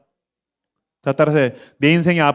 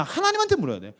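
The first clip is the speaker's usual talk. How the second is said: ordinary talk, nothing marked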